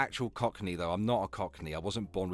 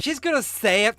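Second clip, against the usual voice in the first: mocking echo; 7 semitones higher, 7 dB louder than usual. Mockingly echoes the other speaker.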